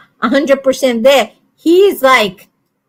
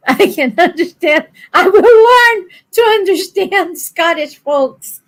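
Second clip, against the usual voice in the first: laughing, excited; 7 semitones higher than usual. Real, not acted. Laughing at own claim.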